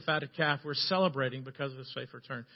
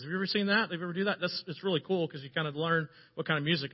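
normal talking voice

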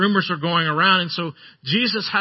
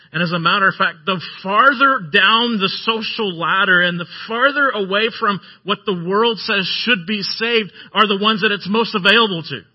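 louder, driving sermon push; preaching; 4 dB louder than usual. Acted. Preacher rousing the congregation, louder and pressing. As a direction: drive the point home to the congregation.

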